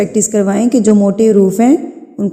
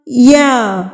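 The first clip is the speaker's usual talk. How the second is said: each letter name drawn out, higher; slow sing-song Qaida recitation; reciting the alphabet slowly; 5 semitones higher, much slower than usual. Shown as a demonstration. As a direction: chant the letters one by one for the class. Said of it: "اسی طریقے سے دو حرکات کے برابر آپ کھینچ کے پڑھیں گے".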